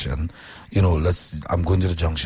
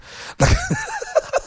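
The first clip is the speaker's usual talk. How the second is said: high shouted laugh at himself. Real, not acted. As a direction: let out a big shrieking laugh. Laughs at his own anger.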